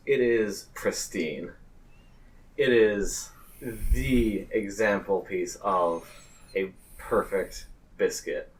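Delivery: normal talking voice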